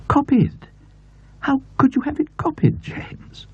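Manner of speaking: put-on high, enthusing elderly aunt; aunt's voice. A narrator's reading. Aunt delighting over the fabric to James, enthused. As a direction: gush over the pattern as the aunt.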